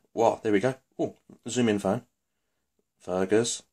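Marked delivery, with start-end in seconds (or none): none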